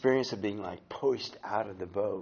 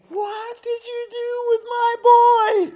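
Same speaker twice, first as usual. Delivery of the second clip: high, slow, loud imitated mom's scream; almost screaming; 13 dB louder than usual. Mom confronting the men over her boy, almost screaming. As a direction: scream it like a horrified mother. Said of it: "almost screaming".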